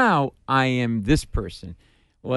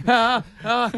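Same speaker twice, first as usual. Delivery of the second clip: rocking yelps; 9 semitones higher than usual. Retold or imitated. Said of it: "I'm rocking".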